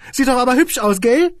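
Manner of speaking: high, amused and chuckly; chuckling squeaky boss. A narrator's reading. Boss jokes about his shelf, high and chuckling. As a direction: giggle through it.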